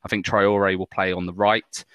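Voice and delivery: ordinary talk, nothing marked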